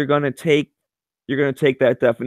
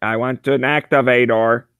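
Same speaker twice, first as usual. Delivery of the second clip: mock alien robot voice; a light robot voice; 3 dB louder than usual. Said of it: "say it in the robot voice".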